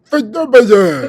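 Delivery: high, loud hammed-up mimicry; mocking echo. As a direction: parrot it back in an exaggerated high wail. Mockingly echoes the clip's word.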